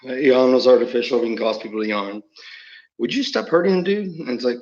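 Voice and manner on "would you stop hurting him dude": higher-pitched second voice scolding; switched-up voice. Acted. One voice scolds the other for hurting him, switched-up high voice. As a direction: put on a higher voice to object. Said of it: "we switch our voices up".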